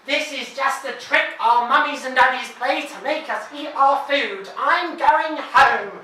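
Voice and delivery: defiant speech. A narrator's reading. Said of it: "it says to all the other kids".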